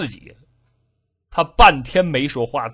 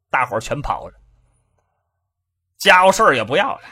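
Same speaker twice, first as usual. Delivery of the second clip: normal talking voice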